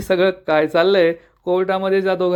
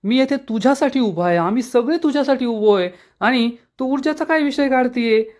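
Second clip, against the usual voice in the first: hurt, much higher voice pleading with his mother; angry son; 7 semitones higher than usual. A narrator's reading. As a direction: rise up high, upset and accusing. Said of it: "Aditya चिडून म्हणतो".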